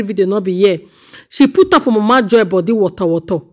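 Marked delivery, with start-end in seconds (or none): none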